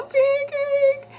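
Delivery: very high, drawn-out call; calling the cat. Retold or imitated. Searching the street for the cat, high calling. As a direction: sing the name out high to find him. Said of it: "I'm calling".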